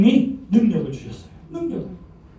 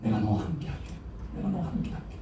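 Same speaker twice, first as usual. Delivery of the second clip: very soft, low, intimate reassurance; tender hushed promise; 5 semitones lower, 13 dB quieter than usual. Acted. God quietly comforting a fearful servant, hushed and tender. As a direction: lean in and promise it gently.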